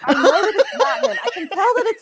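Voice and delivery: high, loud, through real laughter; giggly, helpless protest; laughing admission. Real, not acted. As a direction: insist while cracking up. Amused admission about lookalike voice.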